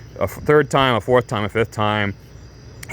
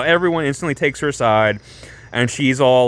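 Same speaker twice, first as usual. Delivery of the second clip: ordinary talk, nothing marked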